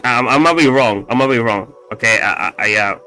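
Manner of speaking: normal talking voice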